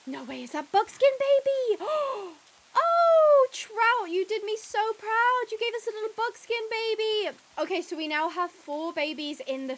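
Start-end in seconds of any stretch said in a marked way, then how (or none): 0.1-1.8 higher, louder disbelieving question; can't believe what she sees; surprised. Real, not acted. Spotting the buckskin foal, surprised and high. 1.9-2.3 high excited gasp; a sharp thrilled breath in. Real, not acted. Seeing the buckskin foal, a thrilled gasp. 2.8-7.4 much higher, louder proud gushing; thrilled praise. Real, not acted. Praising the mare Trout for her foal, thrilled and high.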